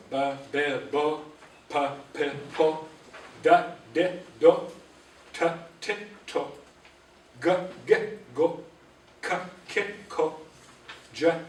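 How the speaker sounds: each syllable said clearly, one by one; reciting the syllable chart. Shown as a demonstration. Teacher reading syllables aloud for the class, clear and measured. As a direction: drill the sounds across the chart. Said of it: "we go across like this".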